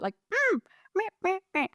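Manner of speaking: high strained effort grunts; mock lifting grunts. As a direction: grunt like pushing a heavy lift. Mimics straining at gym lifts.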